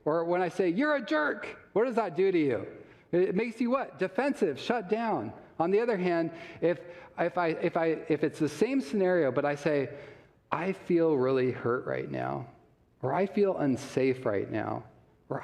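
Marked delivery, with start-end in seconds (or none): 0.7-1.6 mock insult. Shown as a demonstration. Demo of name-calling. 10.5-14.8 gentle I statement. Shown as a demonstration. Demo of calm I statements.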